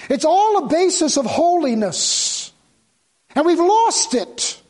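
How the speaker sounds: high, loud pulpit exhortation; impassioned preaching. Real, not acted. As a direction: drive it home to the congregation. Preaches at full force.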